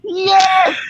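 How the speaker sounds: a shout of victory; triumphant yes. Real, not acted. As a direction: celebrate finishing the trick. Triumph after finishing trick.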